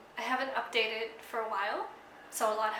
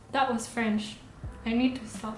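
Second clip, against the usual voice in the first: usual speaking voice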